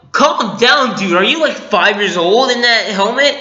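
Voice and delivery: incredulous scolding. Retold or imitated. Fan telling Kylo Ren off for his tantrum, incredulous. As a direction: act out exasperated disbelief at a tantrum.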